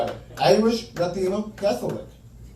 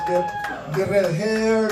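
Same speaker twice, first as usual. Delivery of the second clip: ordinary talk, nothing marked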